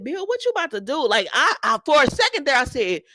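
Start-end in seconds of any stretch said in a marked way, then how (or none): 0.0-1.1 alarmed question. Retold or imitated. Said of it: "And I said".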